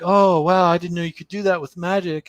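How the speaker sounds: mock-wide-eyed wonder; quoting the girl. Retelling the show, the girl gushes in high amazement. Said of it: "And she's like".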